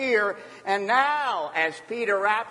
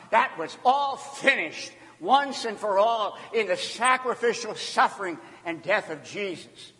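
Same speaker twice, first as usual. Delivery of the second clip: normal talking voice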